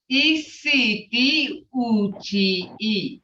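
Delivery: E C D U G E, slow, letter by letter; spelling out sounds. Shown as a demonstration. Teacher spelling Istuji out sound by sound. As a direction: spell the sounds slowly, one by one. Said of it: "See that?".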